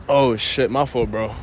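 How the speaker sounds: normal talking voice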